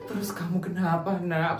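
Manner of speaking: ordinary talk, nothing marked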